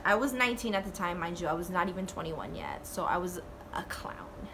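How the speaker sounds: hushed confession, voice dropping to a whisper; whispered aside. Real, not acted. Whispering to viewers about being underage. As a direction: whisper it like a guilty secret.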